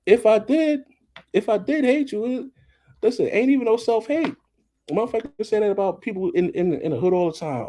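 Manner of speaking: high-pitched, worked-up comeback; heated rebuttal. Acted. Vlogger answering the self-hate accusation, heated and high. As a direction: argue it back, pitch way up.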